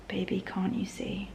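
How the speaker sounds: soft whispered words as if writing them down; whispered lyric. Retold or imitated. She whispers the lyric back while writing it down. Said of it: "I'd write down".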